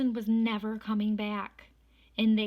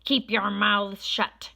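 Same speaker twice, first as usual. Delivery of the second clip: loud, slow, harsh command; stern order; 8 dB louder than usual. A narrator's reading. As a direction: order the class to silence. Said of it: "said Miss Swamp".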